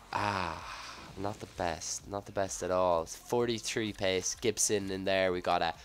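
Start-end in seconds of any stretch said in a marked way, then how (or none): none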